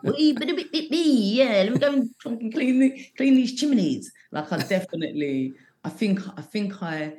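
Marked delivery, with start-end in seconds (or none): 0.0-4.1 mock cockney chimney sweep. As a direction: ham up a cartoon cockney chimney sweep accent. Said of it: "I did get a bit like".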